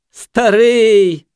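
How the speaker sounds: high, loud, drawn-out hoot; stretched high-pitched jeer; jeering junkie. A narrator's reading. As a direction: whoop it mockingly. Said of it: "засміявся наркоман".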